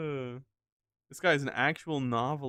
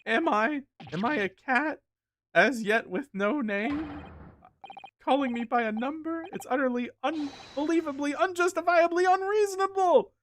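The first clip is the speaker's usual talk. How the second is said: high-pitched indignant rant, climbing higher; complaining tirade; 13 semitones higher, 4 dB louder than usual. Acted. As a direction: act a prisoner's outburst, building to a squeal. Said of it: "That tirade of complaints".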